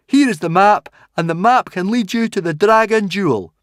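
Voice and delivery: high, loud declamation; Fishlegs reading aloud. Acted. Fishlegs reads out the hidden message, high and loud.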